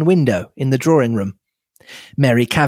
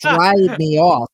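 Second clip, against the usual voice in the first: high, slow European mad scientist voice; Doctor Bauerstein; 4 semitones higher, slower than usual. Acted. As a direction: draw it out in a European accent. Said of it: "European mad scientist".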